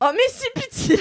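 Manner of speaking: high-pitched comic begging; playful pleading. Real, not acted. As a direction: plead with them, half laughing. Begging friends to allow jokes.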